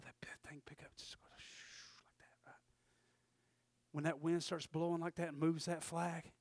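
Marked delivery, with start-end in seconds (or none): 0.0-2.6 hushed whisper fading to nothing. Shown as a demonstration. Pastor picturing a flag lifting, in a whisper. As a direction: drop to a breathy whisper as the wind dies. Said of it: "flag goes still in the wind".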